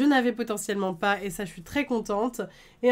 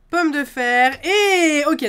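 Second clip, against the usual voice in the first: excited; 5 semitones higher, 9 dB louder, much slower than usual. Real, not acted. Excited reaction to a pulled card.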